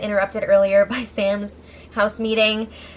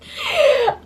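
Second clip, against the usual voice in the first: high amused laugh. Real, not acted. Laughs at convenient backout.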